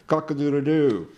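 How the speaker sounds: loud, high crowing cock-a-doodle-doo; rooster crow. Acted. Poet voicing the rooster in the play, crowing loudly.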